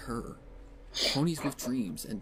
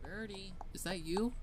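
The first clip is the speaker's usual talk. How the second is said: much higher little filly voice calling out; Sweetie Belle; 9 semitones higher, 5 dB quieter than usual. Acted. Little sister calling for Rarity from afar, high and bright. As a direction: call through the house like a little sister. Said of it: "he's playing Sweetie Belle".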